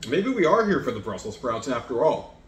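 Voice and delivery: ordinary talk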